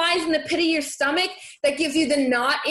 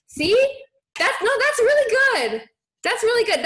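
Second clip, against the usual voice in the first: delighted praise; 8 semitones higher, 3 dB louder than usual. Real, not acted. Praising viewer's brave email.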